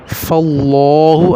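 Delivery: فاللہ slowly, with a thick, dark l; heavy Allah. Shown as a demonstration. Teacher showing zabar before Allah, heavy l in فاللہ. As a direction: round and hold the "lo" of فاللہ. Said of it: "تو یہ موٹا ہوگا".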